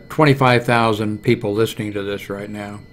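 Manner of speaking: ordinary delivery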